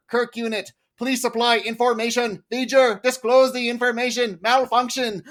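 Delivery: mock machine monotone; robot voice. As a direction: speak like a cold computer demanding data. Mimicking V'ger's machine speech.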